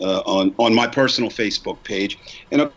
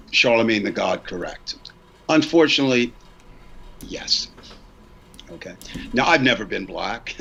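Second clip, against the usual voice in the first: usual speaking voice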